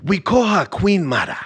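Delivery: a light Wakandan king voice. Acted. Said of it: "Hating on my T'Challa".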